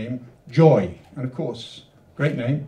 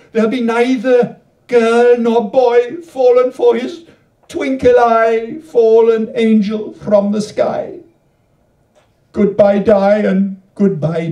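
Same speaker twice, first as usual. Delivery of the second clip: louder, higher, rhythmic verse reading; poem reading; 7 semitones higher, 8 dB louder, slower than usual. Acted. Poet reading her Welsh canto aloud to the audience, louder and higher. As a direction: perform the canto with bouncing rhyme.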